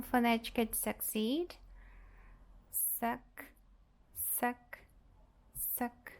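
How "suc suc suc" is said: "suc" soft and short with a schwa; much softer, isolated "suc" as sək; unstressed syllable. Shown as a demonstration. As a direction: say "suc" weakly, vowel reduced to uh. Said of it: "a sílaba átona".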